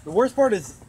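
ordinary delivery

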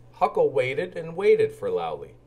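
ordinary delivery, nothing marked

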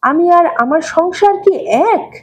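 high, slow, sulky retort; childish anger. A narrator's reading. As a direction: flare up like a hurt child. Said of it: "ছেলে মানুষের মতো রেগে চোখ মুখ লাল করে".

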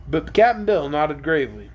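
plain reading voice

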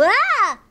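loud, high projected cry; loud demo "ah!". Shown as a demonstration. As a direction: belt out a big open "ah!". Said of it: "Can you get a little bit louder?".

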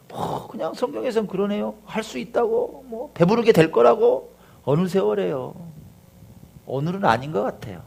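softly grumbled doubt; sceptical believer. Acted. Believer doubting the promise for today, quiet scepticism. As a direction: back off quietly, unconvinced. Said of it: "어떤 마음이냐, 이런 거예요".

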